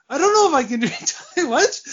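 high, laughing mock disbelief. Player offered tiger drink, laughing in disbelief. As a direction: laugh through the question in disbelief.

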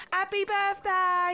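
drawn-out, high retold yell of surprise; mock birthday scream. Retelling her surprise party to her friend, mock drawn-out yell. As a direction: imitate the crowd shouting happy birthday. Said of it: "everyone screamed".

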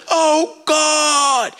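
high, drawn-out imitation of a wailing worshipper; mock scream-crying. Front-row worshippers crying out to God, high mock wail. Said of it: "scream crying and yelling".

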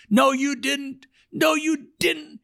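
high, slow mock old lady scolding; hammed-up high old woman voice. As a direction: squeak out an old woman's reproach.

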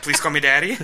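high, loud plea; mock begging. Mocking someone begging a partner, loud high pleading. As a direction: plead with exaggerated neediness. Imagined begging to be called daddy.

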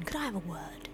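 hushed private request; whisper. Acted. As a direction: whisper it to the captain. Asking captain privately.

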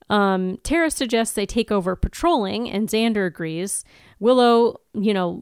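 ordinary delivery

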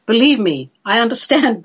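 usual speaking voice

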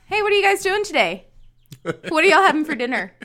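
loud, eager mock chumminess; over-friendly greeting. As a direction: chat up strangers like long-lost best friends. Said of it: "Act as if they are our long-lost best friends".

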